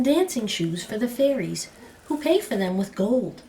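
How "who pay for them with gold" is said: usual speaking voice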